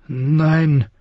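hohes, ängstliches Flehen; flehend. A narrator's reading. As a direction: bettle um Gnade. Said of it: "flehte er". (der verletzte Prinz fleht Arya an, ängstlich)

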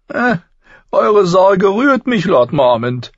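deutlich höher und lauter, spöttischer Zwerg; Tyrion, hohe Stimme. A narrator's reading. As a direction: hell und keck sprechen, wie ein Zwerg. (Tyrion antwortet dem Lord Commander, hoch und keck)

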